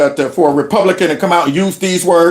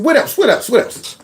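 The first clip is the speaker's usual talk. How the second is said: urging on, high; 6 semitones higher than usual. Real, not acted. Urging the speaker on, repeated.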